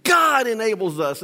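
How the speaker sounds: usual speaking voice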